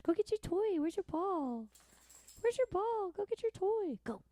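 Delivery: put-on very high baby-talk voice to a dog; high sing-song dog talk. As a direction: coo at the puppy like a squeaky toy.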